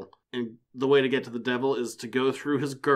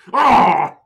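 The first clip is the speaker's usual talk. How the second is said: very loud, high mock cry of pain; hammed-up wounded yelp; terrible-voice cry; 9 semitones higher, 14 dB louder than usual. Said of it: "That's a terrible voice".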